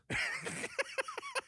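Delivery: high giggle. Real, not acted. Laughing at misprint.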